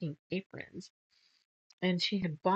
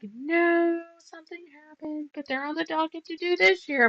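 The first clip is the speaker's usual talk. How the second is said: higher, lightly voiced woman's reply; breezy put-off excuse, pitched up; customer voice; 11 semitones higher, 5 dB louder than usual.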